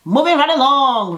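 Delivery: high, loud "moving right along"; showy transition. Real, not acted. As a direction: announce the next scene with flair. Recap host's signature transition.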